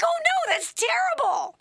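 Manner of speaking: very high, wailing mock dismay; distressed sympathy. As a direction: cry out in pity, hammed up. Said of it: "I felt so sorry for him".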